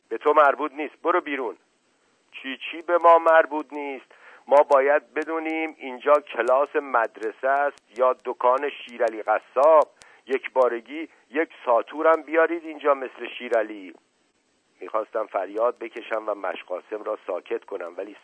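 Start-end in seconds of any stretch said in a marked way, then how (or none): 0.1-1.6 angry order. A narrator's reading. Teacher orders servant out. 2.3-13.9 loud, higher heated comeback; angry retort. A narrator's reading. Mash Qasem hitting back loudly at the angry teacher.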